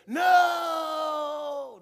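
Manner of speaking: a lightly voiced child saying no; child's answer. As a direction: answer like a small Sunday school kid. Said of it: "the children said".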